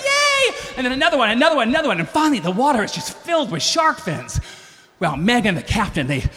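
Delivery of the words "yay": even higher, louder mock cheer; crowd cheer. Tourists seeing more fins, cheering high. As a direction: squeal it with more glee. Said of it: "and everyone goes".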